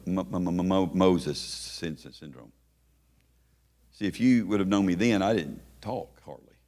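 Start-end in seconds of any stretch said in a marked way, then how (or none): 0.1-2.5 low, stammered false starts; mock stutter. Preacher joking about his shy speech as a youth, mock stutter. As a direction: stutter on the first sounds, sinking away. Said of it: "And then I came with".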